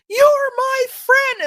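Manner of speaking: put-on high, slow, loud little-girl voice. Little girls at church greeting each other joyfully. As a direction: sound like a delighted preschooler. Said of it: "they're like".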